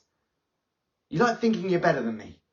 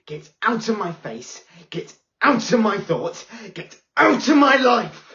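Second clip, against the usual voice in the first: starts level, each line louder and higher until a full shout; building to a shout; 6 semitones higher, 8 dB louder than usual. Acted. Pupil drives teacher out of her life, building to a shout. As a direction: climb from firm to yelling.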